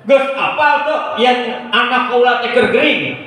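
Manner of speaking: high, declaiming royal voice; commanding king. Acted. King telling minister of sick child, high imperious voice. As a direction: proclaim it high, as a ruler. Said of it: "Aya Kanjeng Raja".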